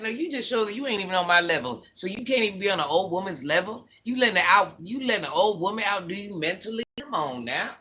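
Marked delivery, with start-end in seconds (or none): none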